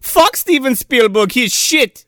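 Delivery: high, scornful mock German accent; Uwe Boll. Director dissing his rival, mock German accent. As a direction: sneer as a hammed-up German director. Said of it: "That's what he sounds like".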